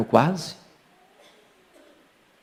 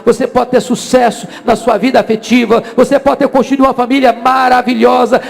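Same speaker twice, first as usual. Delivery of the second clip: raised, insistent pulpit voice; impassioned preaching; 8 semitones higher, 5 dB louder than usual. Real, not acted. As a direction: drive the warning home in high, mounting phrases. Preaches at full force. (pregação inflamada, em voz alta)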